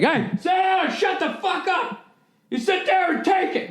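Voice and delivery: high strained yell, acted as an angry parent; angry family shouting. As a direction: shut a kid down with a mock shout. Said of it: "I came from one of those families like".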